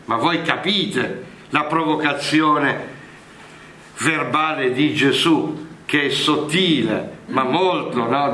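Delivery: ordinary talk, nothing marked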